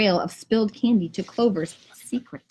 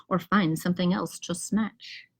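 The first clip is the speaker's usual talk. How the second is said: usual speaking voice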